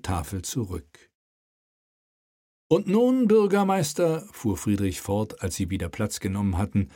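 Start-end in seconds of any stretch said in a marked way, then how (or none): 2.7-4.3 raised, commanding royal voice; king's voice. Acted. King prods the mayor at the table, commanding.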